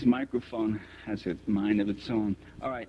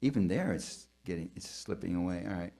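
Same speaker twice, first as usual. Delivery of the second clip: ordinary talk, nothing marked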